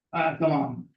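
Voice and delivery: ordinary talk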